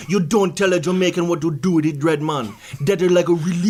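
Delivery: mock-indignant Jamaican patois; fake Jamaican accent. Guest defending sacred dreads, fake Jamaican accent. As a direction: scold in a put-on Jamaican accent. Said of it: "this is not your real accent".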